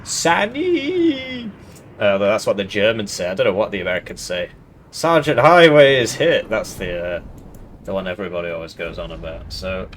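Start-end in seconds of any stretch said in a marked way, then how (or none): 0.0-1.5 high-pitched mock scream for a medic; imitated scream. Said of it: "and then somebody screams". 4.9-6.5 loud, higher mock battlefield cry; imitated scream. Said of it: "and then somebody screams".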